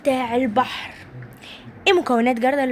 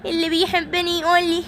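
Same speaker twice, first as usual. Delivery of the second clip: high, whimpering, hammed-up sobs; mock crying toy; 5 semitones higher than usual. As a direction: wail about being lost, high. Simba alone, fake crying. (بكاء تمثيلي بصوت عالٍ)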